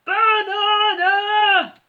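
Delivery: slow, loud, high booming bellow; gorilla roar. A narrator's reading. Gorilla answering Terry with a booming roar. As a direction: stretch the word into a roar. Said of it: "roared the gorilla in response".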